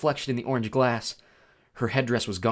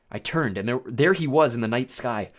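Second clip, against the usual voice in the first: usual speaking voice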